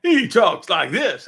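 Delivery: AM DJ voice. Retold or imitated. Guest mimicking the co-host's show voice, hammed-up DJ. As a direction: stretch it out like a radio DJ. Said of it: "very A M D J kind of thing".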